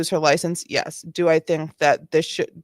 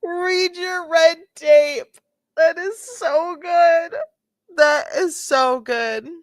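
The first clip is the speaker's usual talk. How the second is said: laughing; 9 semitones higher, 5 dB louder, slower than usual. Real, not acted. Host laughing at her line.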